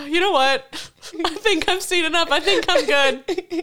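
high, slow, giggly resignation; laughing. Retold or imitated. Echoing her friend's joke about having seen enough, laughing. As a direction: laugh through a mock surrender.

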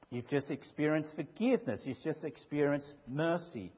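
ordinary talk

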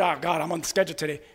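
low, quick, muttered complaint; grumbling. Acted. Preacher mimicking a volunteer on the church schedule, grumbling. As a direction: grumble it softly to yourself. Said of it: "you're already coming grumbling".

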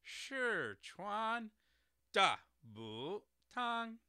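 "Sichuan Dabutang" syllable by syllable, slowly, with exaggerated Mandarin tones. Shown as a demonstration. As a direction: say "Sichuan Dabutang" rising, rising, sharply falling, dipping, then high and level. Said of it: "somewhat correct pronunciation".

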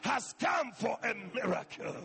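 loud impassioned sermon voice; fervent preaching. Acted. Pastor urging the congregation toward a miracle, fervent. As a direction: preach it with rising fervor.

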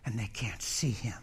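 breathy hushed whisper. Acted. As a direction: lean in and whisper it. Preacher drops to a whisper.

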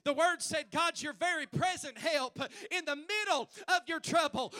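loud preacher climbing to a high shout; rising, fervent pulpit shout; building preaching. Acted. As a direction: build to a full-force cry, then hold out STILL. Preaching builds to full force.

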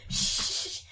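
breathy hushing sounds; shushing. A narrator's reading. Mungo Jerry hushing his sister at the cocoon. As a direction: hush her urgently. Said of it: "Mungo Jerry shushed her".

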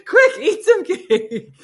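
high, loud, drawn-out laughing squeal; laughing through tears. Real, not acted. Joking to viewers while overcome, tearful laughing squeal.

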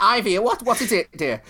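a lightly voiced concerned old lady. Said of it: "the second one went".

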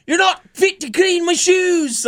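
high shouted British insult, drawn out; over-the-top shouted taunt; mock scream. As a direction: scream it like a ranting villain. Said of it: "He just kept screaming this over and over".

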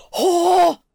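loud, delighted "Oh!"; excited gasp. Retold or imitated. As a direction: burst out with surprised joy. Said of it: "And he goes".

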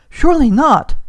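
high, loud exclamation of disbelief; shocked disbelief. A narrator's reading. Hugh hears of the father's death, shocked disbelief. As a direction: reel from dreadful news. Friend reacts to news of death.

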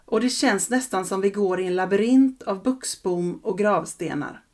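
ordinary delivery

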